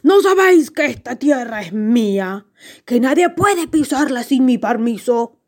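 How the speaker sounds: put-on booming giant voice; thundering giant. A narrator's reading. Giant warns intruders off his land, thundering voice. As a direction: scold like a territorial storybook giant. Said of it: "con voz de trueno".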